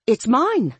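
high, too-cheerful claim; bright smile. A narrator's reading. Dory claims the rubber ducky, smiling brightly. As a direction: pipe up high through a big smile. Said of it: "I smiled brightly".

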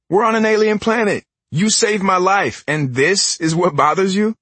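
high, incredulous protest; exasperated. Acted. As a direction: plead in disbelief.

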